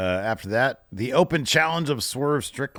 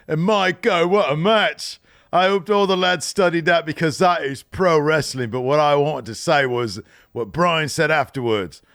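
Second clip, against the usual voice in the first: put-on higher British accent promo voice; Will Ospreay impression; 4 semitones higher than usual. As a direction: cut the promo like a cheeky Brit. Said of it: "I love your Ospreay impression".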